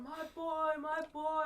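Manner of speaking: imitating a heartbroken dad; grieving father. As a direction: ham up a father's wail over his son. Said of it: "tragic, he's so upset, he's going".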